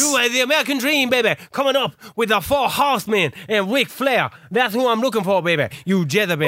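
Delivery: rolling wrestler promo impression; Dusty Rhodes. Showing off his wrestler to the co-host, promo voice. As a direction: deliver it like a hammed-up wrestling promo. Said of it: "Yeah, you did the voice".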